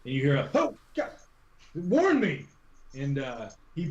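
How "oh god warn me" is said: high, startled cry of protest; startled yelp. Acted. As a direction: yelp it much higher, caught off guard. Voices someone startled at pass-through.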